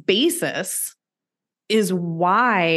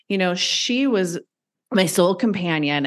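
usual speaking voice

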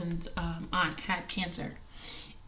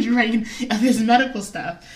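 laughing; 3 semitones higher, 10 dB louder than usual. Real, not acted. Laughing at being left in charge.

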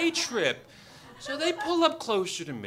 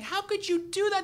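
high-pitched scolding, only mildly raised; mock screaming; 12 semitones higher, 3 dB louder than usual. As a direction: imitate a wife screaming in reproach. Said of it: "then she starts screaming".

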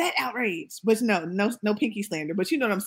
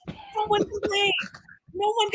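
mock outrage; 11 semitones higher than usual. Real, not acted. Mock outrage about Slutty Vegan.

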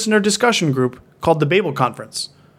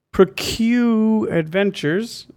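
slow drawn-out title; 5 semitones higher, much slower than usual. Pretend. Mock title of fake podcast.

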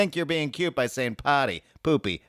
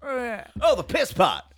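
mock Cockney reply; 12 semitones higher, much slower than usual. Answering in a Cockney voice.

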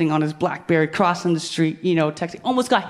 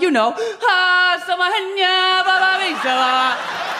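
higher, slow, exaggerated gibberish; hammed-up fake African; 11 semitones higher, 3 dB louder, slower than usual. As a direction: stretch out the fake words like a bold performance. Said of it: "I'm like".

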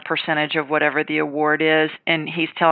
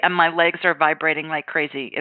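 ordinary talk, nothing marked

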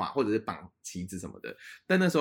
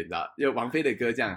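usual speaking voice

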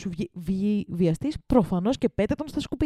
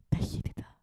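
whispered to herself; breathy whisper, a private joke; 6 dB quieter than usual. Real, not acted. Whispering a joke to herself mid-podcast.

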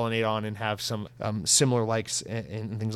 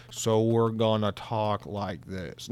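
voce lenta e meccanica da sintetizzatore vocale; robot speech voice; 3 semitones lower, much slower than usual. Shown as a demonstration. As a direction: parla come un assistente vocale Microsoft, a mezza velocità. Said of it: "slow the speed ... speech assistant program voice".

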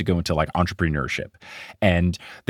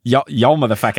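higher, louder try at Kiwi accent; New Zealand accent attempt; 4 semitones higher, 4 dB louder than usual. Shown as a demonstration. Podcaster trying the quote for co-host, higher New Zealand accent. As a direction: have another go at sounding New Zealand. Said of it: "You're doing Brit from Flight of the Conchords".

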